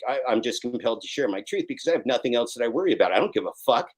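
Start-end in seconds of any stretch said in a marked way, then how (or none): none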